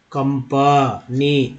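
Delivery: "कंपनी" very slow, syllable by syllable, short a in प; pronunciation demo. Shown as a demonstration. Teacher showing how A is read, "कंपनी" slowly broken into syllables. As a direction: draw out "कंपनी" as kam-pa-nee. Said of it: "कंपनी में ए को क्या पढ़ते हैं".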